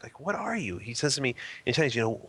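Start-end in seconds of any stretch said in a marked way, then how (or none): 0.2-0.8 mock inquisitive question, pitched up. Drunk man sizing up the stranger nudging him, mock inquisitive. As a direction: lightly imitate a puzzled drunk peering at you. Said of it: "This inquisitive look".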